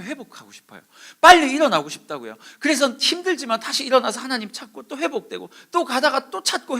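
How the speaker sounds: high, loud, urgent pleading; frustrated plea. Acted. Young believer begging the pastor for resilience, loud and desperate. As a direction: build from a question into insistent protest.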